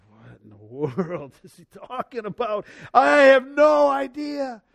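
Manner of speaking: amused bafflement, last words high and loud; laughing at his own confusion; baffled. Retold or imitated. Preacher reenacting his bafflement over a Revelation passage, amused. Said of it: "and I go".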